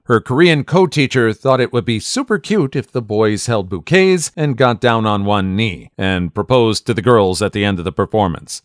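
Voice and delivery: plain reading voice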